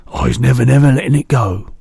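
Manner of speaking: loud firm outcry; determined cry. A narrator's reading. BFG promising Sophie, loud determined cry. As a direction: vow it loudly. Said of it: "the B F G cried".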